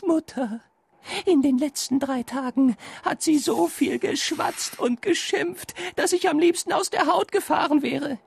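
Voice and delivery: high, pleading serpent voice; pleading snake. A narrator's reading. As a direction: beg like a desperate snake. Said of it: "die Schlange bat flehentlich".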